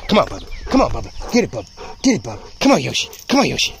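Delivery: urging a dog on in short bursts; playful handler's encouragement; coaxing a dog. Real, not acted. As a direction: egg the dog on to tug. Urging dog on during tug.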